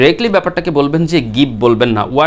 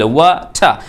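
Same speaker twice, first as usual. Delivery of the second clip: British "ওয়া ঠা" in two split syllables, t aspirated; British accent; much slower than usual. Shown as a demonstration. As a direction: break it into "ওয়া ঠা", British, no final r. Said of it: "ট সাউন্ডটি ঠ হচ্ছিল". (British উচ্চারণে "ওয়া ঠা", ঠ স্পষ্ট)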